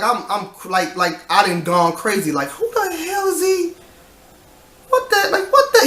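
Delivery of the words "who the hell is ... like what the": very high, incredulous squeal; mock disbelief. Voicing manager who thinks worker's gone crazy, mock incredulous. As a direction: imitate baffled outrage in a squeaky high voice.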